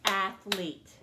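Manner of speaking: athlete split into two clapped beats, ath and lete; clapped out by syllable. Shown as a demonstration. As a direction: clap each syllable of athlete. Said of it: "Let's clap it out".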